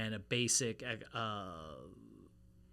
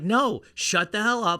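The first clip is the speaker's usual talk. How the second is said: mock yell; 8 semitones higher, 8 dB louder, slower than usual. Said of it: "he's like".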